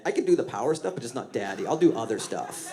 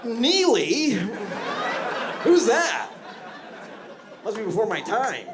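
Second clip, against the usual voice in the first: much higher, louder, playing young and clueless; feigned ignorance; 12 semitones higher, 4 dB louder than usual. Fake-Shazamming a [name] song to a younger date, much higher and louder. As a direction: fake it like the song is new to you.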